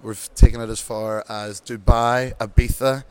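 usual speaking voice

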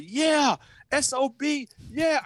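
high yelled cheer; retold scream. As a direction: shout it out like a wild teammate. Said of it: "He screamed".

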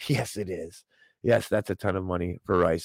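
ordinary talk, nothing marked

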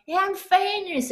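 proud squeal of triumph; excited. Retold or imitated. Retold self boasting.

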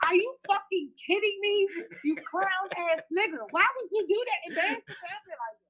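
high-pitched pretend girlfriend chewing out her man; mock scolding girlfriend. Girlfriend scolding her boyfriend over the bowling fight, high and shrill-free mock rant. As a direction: play the embarrassed girlfriend yelling at him.

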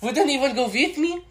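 high put-on French maid voice; Yvette impression. Yvette from Clue, scared of the dark. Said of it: "from Clue when she goes".

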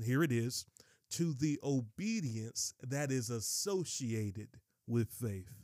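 slow, deliberate scripture reading with pauses. Real, not acted. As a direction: read it out slowly so every phrase lands clearly. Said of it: "Let me slow down".

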